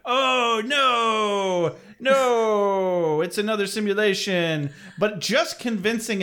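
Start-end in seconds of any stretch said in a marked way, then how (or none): 0.1-4.7 mock surprise. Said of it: "does not sound convincingly surprised".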